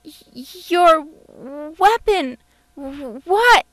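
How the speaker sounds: high, loud, halting fright; stumbling, scared. A narrator's reading. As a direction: stammer it out in alarm. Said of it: "William stumbled".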